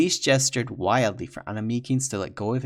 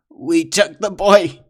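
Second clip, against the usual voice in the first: slow strained gasping words; choked while held by the throat; gasping out a confession; gasped; 7 semitones higher, 12 dB louder, slower than usual. Acted. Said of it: "Babish gasped".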